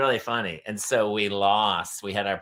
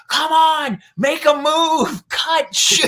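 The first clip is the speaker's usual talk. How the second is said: high, loud sideline shouting; shouted soccer commands from the touchline; coach yelling; 8 dB louder, much slower than usual. Retold or imitated. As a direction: yell urgent orders at young players.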